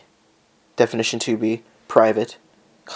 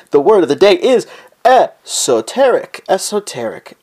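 high, loud announcer reading; mock announcement; 6 semitones higher, 4 dB louder than usual. Presenting the word of the day, mock announcer. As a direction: announce it with flair.

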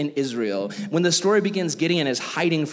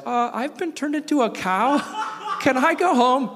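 much higher, silly whiny voice with a laugh; Emperor's New Groove impression; 10 semitones higher than usual. Cartoon emperor turned into a cow asks to go home, high and whiny. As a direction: play the cartoon emperor asking to leave. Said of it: "Emperor's New Groove where he's like".

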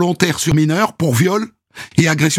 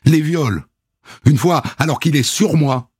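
ordinary talk

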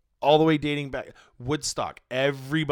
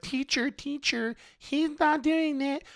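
much higher, slow whiny tattletale; tattling child; 13 semitones higher, slower than usual. Retold or imitated. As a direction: whine it like a kid telling on someone. Mocking girl running to usher.

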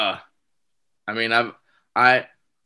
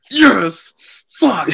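higher, louder, gleeful yes; mock triumphant cheer; 6 semitones higher, 8 dB louder than usual. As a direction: celebrate getting the reaction. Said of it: "And I was like".

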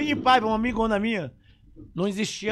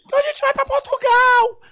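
much higher, louder insistent shout; wife demanding the trip, hammed up; mock shrieking wife; 12 dB louder than usual. Wife insists to her husband on travelling, much higher mock shout.

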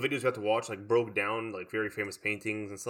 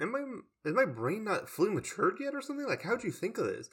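much higher, incredulous questions; baffled; 9 semitones higher than usual. Retold or imitated. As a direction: sound genuinely lost and amazed. Baffled at the paintings.

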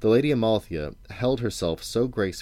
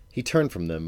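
usual speaking voice

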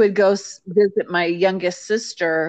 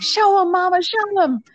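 egging on; 11 semitones higher than usual. Retold or imitated. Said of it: "just laughing and like".

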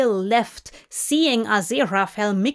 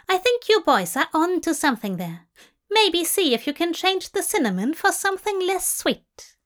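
higher, fast, knowing advice; breezy Tracy; 8 semitones higher, faster than usual. A narrator's reading. Tracy leaving the boys with advice, high and breezy. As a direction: toss off the tip brightly.

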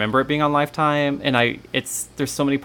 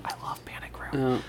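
whispering; 10 dB quieter than usual. Real, not acted. Playful aside about favourite movie.

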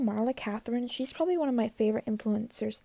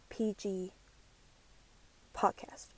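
whispered aside; 4 semitones lower, 6 dB quieter, slower than usual. Acted. Coy whisper, rated PG joke.

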